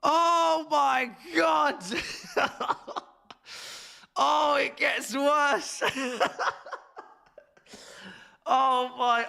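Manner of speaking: high shouted outrage, slow and stretched; shocked shout. Real, not acted. Fan sees the team sheet, shouts in high shock. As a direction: cry out in horror at the news. More bad news, shouting.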